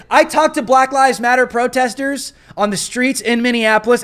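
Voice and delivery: ordinary talk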